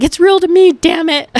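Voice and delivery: mock outraged fan. Quoting the meme fan insisting wrestling is real. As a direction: bellow it like the famous upset fan. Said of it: "that man that was like".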